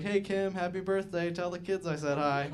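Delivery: high-pitched, breezy birthday hello; mock cheery greeting. Friend posting to dead mom's page, mock cheery greeting. Voices oblivious birthday posters.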